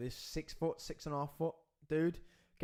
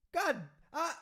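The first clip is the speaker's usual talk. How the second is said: exasperated burst; 14 semitones higher, 3 dB louder than usual. Retold or imitated. Exasperated at woman.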